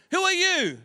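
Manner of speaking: high, called-out echo; high-pitched mock holler, thrown back. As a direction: mimic a yell bouncing back. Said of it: "a voice replied".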